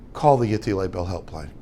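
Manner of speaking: low, soft deadpan; sleepy brush-off. Retold or imitated. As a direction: drop it drily and be done. Sleepy husband brushing wife off.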